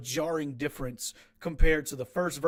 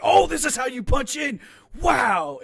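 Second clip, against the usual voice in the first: excited discovery; 9 semitones higher, 9 dB louder than usual. Retold or imitated. Retelling a sudden discovery.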